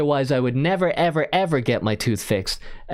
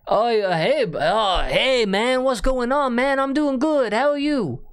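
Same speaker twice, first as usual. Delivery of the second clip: exaggerated high-pitched Mexican accent; mock Mexican accent; 10 semitones higher than usual. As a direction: greet the caller in a hammed-up, higher Mexican accent. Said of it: "what's so racist about doing an accent".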